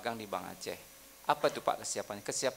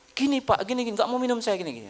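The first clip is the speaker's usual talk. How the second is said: annoyed customer; 10 semitones higher, 4 dB louder, faster than usual. Retold or imitated. Angry customer refusing water.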